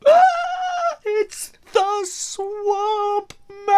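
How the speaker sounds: very high wailing sing-song; high, slow, warbling swamp-creature wail; desperate watery voice. Acted. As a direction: wail it like a drowning monster. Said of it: "in a desperate, watery voice".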